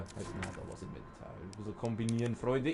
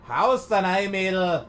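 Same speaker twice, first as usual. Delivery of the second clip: louder cocky taunt; 3 semitones higher, 7 dB louder than usual. Real, not acted. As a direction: bark it at the enemy. Taunting while fighting.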